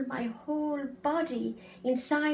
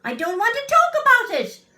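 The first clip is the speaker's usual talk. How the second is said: much higher, louder and fast; angry refusal; 12 semitones higher, 12 dB louder, faster than usual. Acted. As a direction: snap it out in anger. Child refuses, anger grows.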